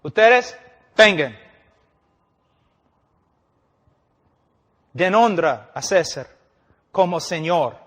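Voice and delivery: barked soldier's order; loud soldier's command; soldiers' command. Acted.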